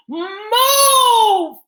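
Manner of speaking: long, high, loud scream. A narrator's reading. Girl forcing her way through the crowd, screaming. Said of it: "Pandora screamed".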